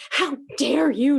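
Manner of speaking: ordinary talk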